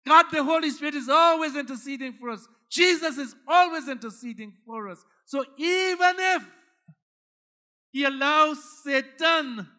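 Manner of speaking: loud, high, rising preacher's fervor; whooping climax of the sermon; full-force preaching. Acted. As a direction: drive it home to the congregation.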